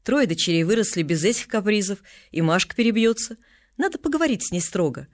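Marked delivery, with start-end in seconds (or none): none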